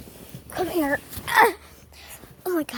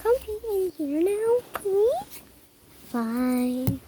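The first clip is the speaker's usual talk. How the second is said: ordinary delivery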